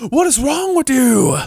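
mock dramatic outburst. Quoting wrestling skit.

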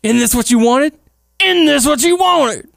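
high, loud and slowed mock villain line; melodramatic taunt. Quoting Matt taunting Jeff, high and hammed-up. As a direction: taunt like an over-the-top wrestler.

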